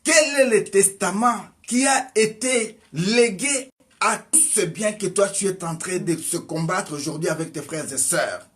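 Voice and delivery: ordinary talk, nothing marked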